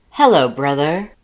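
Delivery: a sweetly triumphant, booming hello; sweet brother greeting. Acted. Papyrus greets his brother, loud and sweet. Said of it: "Papyrus turned around, smiling sweetly".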